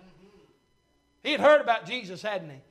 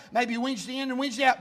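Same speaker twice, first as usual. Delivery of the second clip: normal talking voice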